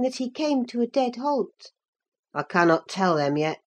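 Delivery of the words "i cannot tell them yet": low-voiced, halting country lad; rustic young man. A narrator's reading. Cousin admits he cannot read numbers, low rustic voice. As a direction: drop the voice low as the unlettered lad. Slow-witted cousin answers Catherine.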